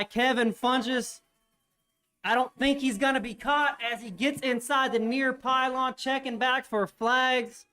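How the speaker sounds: rising, loud touchdown call; excited play-by-play. Acted. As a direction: call the pick-six with growing excitement. Calls an interception touchdown.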